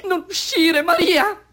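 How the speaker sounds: high, urgent cry; desperate plea. Acted. (supplica disperata, acuta)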